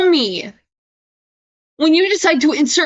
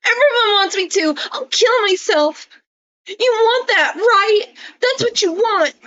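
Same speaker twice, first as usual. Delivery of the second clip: pleading mock cry, pitched way up; mock whiny Isaac; 6 semitones higher than usual. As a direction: ham up a needy friend begging not to be left. Reading Isaac's pleading messages aloud.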